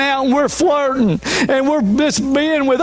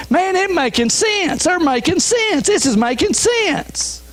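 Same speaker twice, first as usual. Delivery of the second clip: higher, convinced inner thought; mock dawning agreement, pitched up; persuaded inner voice; 8 semitones higher than usual. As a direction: sound won over by the culture. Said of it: "we start in our mind think".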